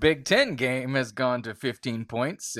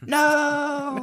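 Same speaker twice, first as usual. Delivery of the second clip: squeaky no; 6 dB louder than usual. Pretend. High squeaky mock refusal.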